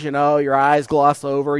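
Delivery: ordinary talk, nothing marked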